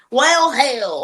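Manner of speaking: hammed-up country twang, drawn out. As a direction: belt it like a country karaoke singer with a twang. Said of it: "karaokeing some country songs".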